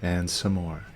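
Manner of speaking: measured meditation guidance with long silences and falling endings; guided meditation. Real, not acted. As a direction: lead them inward, one short instruction at a time.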